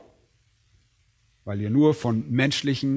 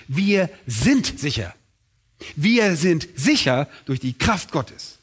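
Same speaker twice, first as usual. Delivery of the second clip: slow, weighted "Wir sind sicher", each word set apart; emphatic assertion; slower than usual. Real, not acted. Preacher stressing to the congregation what Scripture affirms, "Wir sind sicher" slow and emphatic. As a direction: drive home "Wir sind sicher" slowly and firmly. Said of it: "betont das mit allem Nachdruck".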